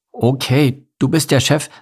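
lightly voiced little hedgehog agreeing; compliant hedgehog. A narrator's reading. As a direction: go along with the boss. Hedgehog gives in to bear.

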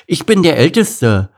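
put-on loud, high bossy kid; indignant cub. A narrator's reading. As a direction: insist on being in charge, affronted. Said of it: "empörte sich Lukas".

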